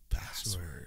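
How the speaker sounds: soft, seductive breathy whisper; sexy voice. Shown as a demonstration. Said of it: "but in a sexy voice".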